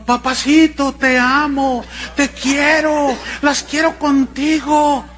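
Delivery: hammed-up fans calling to the singer; mock fan yell. Pastor retelling how the sisters shouted at the singer, mock yell. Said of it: "Las que estaban alrededor gritaban".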